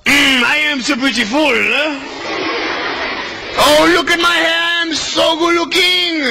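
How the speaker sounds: preening in the mirror, pretend swooning; mock vanity. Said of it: "look at yourself and go".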